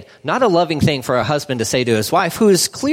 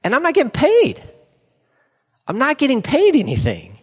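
frustrated; 6 semitones higher than usual. Acted. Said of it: "he's so frustrated 'cause he's like".

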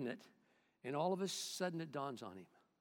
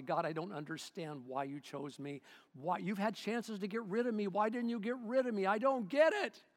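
rising, growing louder plea; pleading prayer; 5 semitones higher, 5 dB louder than usual. Retold or imitated. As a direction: pour out your heart, building. Pastor's prayer retold, pleading.

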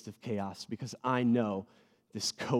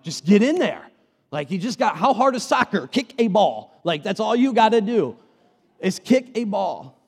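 loud, high, incredulous competitive dad; exasperated urging; 9 semitones higher, 12 dB louder than usual. Retold or imitated. Competitive dad to his nervous daughter, exasperated and loud. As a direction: push them loudly, as if it's dead simple.

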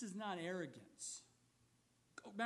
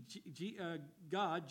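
usual speaking voice

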